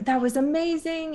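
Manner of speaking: high-pitched, imitated awe; amazed praise. Companion praises her after the ritual, amazed. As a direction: gush it as the impressed friend.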